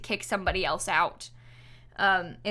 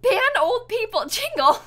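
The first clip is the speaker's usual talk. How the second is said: laughing joke; 11 semitones higher than usual. Real, not acted. Joking reaction to an old villager.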